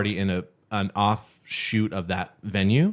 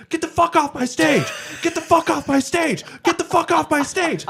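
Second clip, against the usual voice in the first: furious yell. Retold or imitated. Sound guy roaring at a trespassing lady, furious yell. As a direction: scream him off the stage. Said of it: "so he started yelling".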